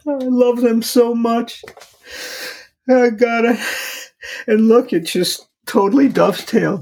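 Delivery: high, strained voice close to crying; barely holding it together; voice breaking. Real, not acted. Father admitting to the host how hard it is, voice breaking.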